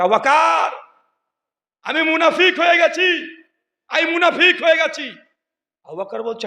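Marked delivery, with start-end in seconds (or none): 0.0-5.1 high, anguished shouted confession; crying out. Acted. Hanzala confessing hypocrisy to Abu Bakr, crying out. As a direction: shout it desperately to a friend. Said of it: "চিৎকার দিয়ে".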